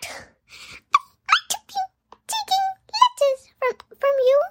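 high squeaky crying house elf; squeaky Dobby voice. Retold or imitated. Retelling how Dobby pleaded with Harry, squeaky and tearful. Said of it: "It was so squeaky".

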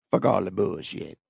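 normal talking voice

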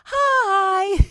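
a sugary greeting, sung up high; sing-songy hi. Retold or imitated. Flight attendant greeting each passenger, sing-song Hi. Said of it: "such a sweet hi".

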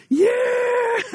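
put-on sudden high shout of joy, kid-like; excited kid. Imitating his child thrilled about the store, excited high shout.

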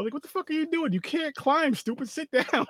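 high-pitched mocking telling-off; mock scolding. Mocking scolding of a failed climber.

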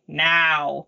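mock demanding meow, pitched low; low, insistent cat-like meow; imitated cat scream. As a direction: imitate a cat yowling for attention, without real screaming.

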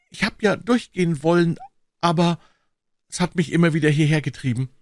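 high, tearful confession; heartbroken sobbing. A narrator's reading. Accused man saying something drove him back, high sobbing. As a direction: sob it out, pitched well up. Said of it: "wieder schluchzte er herzbrechend".

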